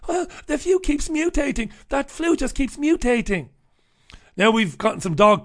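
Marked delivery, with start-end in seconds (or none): 0.1-3.5 higher, prissy official excuse; lightly voiced excusing official; mock health official. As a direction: whine the excuse like a fussy official. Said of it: "The excuse from the health officials".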